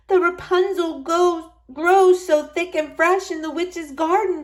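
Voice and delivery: wife craving the salad, pleading; longing wife. A narrator's reading. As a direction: yearn for it aloud. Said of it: "she said".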